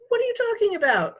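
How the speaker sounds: high, loud startled question; shocked big brother; alarmed boy. Acted. Peter shocked at news.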